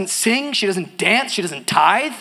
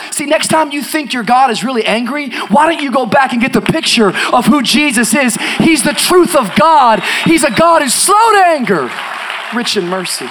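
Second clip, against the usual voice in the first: louder, higher, driving sermon voice; full-force preaching; 6 semitones higher, 8 dB louder than usual. Acted. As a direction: preach it out over the congregation.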